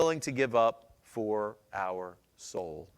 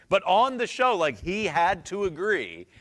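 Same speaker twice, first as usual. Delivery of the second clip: excited; 7 semitones higher, 8 dB louder than usual. Real, not acted. Excited retelling of show.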